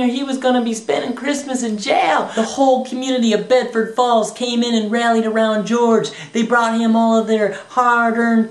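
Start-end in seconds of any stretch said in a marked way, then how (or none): none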